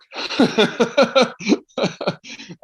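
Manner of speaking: loud rising burst of laughter; long high laugh. Real, not acted. Comedian recalling success, long high laugh. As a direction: laugh with relief.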